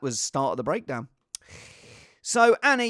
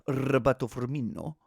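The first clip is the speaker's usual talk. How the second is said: Firmino said in a light mock Italian accent; 4 semitones lower than usual. Mock Italian accent for Brazilian striker Firmino. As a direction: roll Firmino like a hint of an Italian. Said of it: "though I did an Italian accent".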